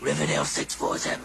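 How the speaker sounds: very low, voiceless rasp; laryngitic croak. Acted. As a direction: croak the number with no voice left. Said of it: "she can't hear me".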